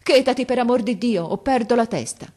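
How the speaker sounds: high, loud plea at breaking point; desperate shout. A narrator's reading. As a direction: beg her loudly to stop, distraught. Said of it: "le gridò il muratore disperato".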